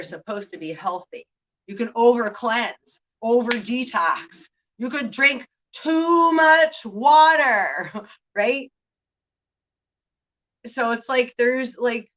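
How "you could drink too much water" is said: mock shout. Mock alarm at overdoing.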